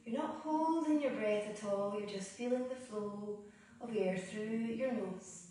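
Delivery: soft, unhurried yoga breathing cues with long pauses; guided meditation. Acted. Yoga teacher guiding students through Ujjayi breath, soft meditation voice.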